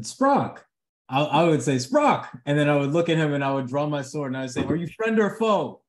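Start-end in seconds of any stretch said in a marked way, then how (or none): 0.0-0.6 high-pitched in-character call; calling out a name. Acted. Player calls out in character. 1.8-2.3 high, falling call to a friend; calling out a name. Acted. Player repeats in-character call. 4.6-5.8 high-pitched challenge to a stranger; in-character challenge. Acted. Drawn sword, challenging a stranger.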